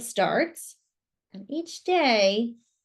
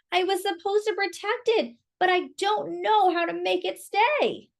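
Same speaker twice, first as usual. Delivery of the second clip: 1 worried pleading; 8 semitones higher than usual. Acted. Emil upset to the bird.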